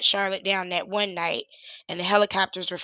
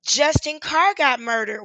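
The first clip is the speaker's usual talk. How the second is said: ordinary talk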